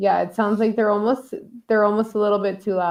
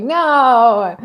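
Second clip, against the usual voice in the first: loud retold no; 4 semitones higher, 10 dB louder than usual. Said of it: "I was like".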